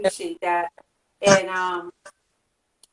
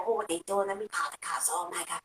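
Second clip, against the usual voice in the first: a hushed, much softer mock busybody; nosy snitch; 4 semitones lower, 12 dB quieter than usual. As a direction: lightly play a gossiping neighbor, whispering low. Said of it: "snitch and be like".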